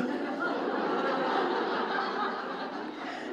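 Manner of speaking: a long quiet laugh at the story; long breathy laugh. Real, not acted. Preacher laughing at the story before the punchline, long soft laugh. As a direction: laugh through it, barely voiced.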